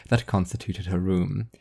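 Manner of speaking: ordinary delivery, nothing marked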